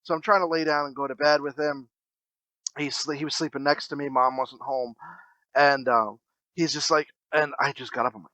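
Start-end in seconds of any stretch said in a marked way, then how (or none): none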